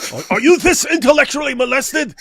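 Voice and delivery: high, loud hammed-up tirade; mock outraged shout. Host berates a pope praising Islam, mock outraged shouting. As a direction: yell it in exaggerated disbelief.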